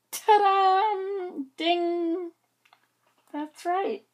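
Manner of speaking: high, bright-eyed ta-da of discovery; eureka. Real, not acted. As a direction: ring it like a bell. Realizing the answer.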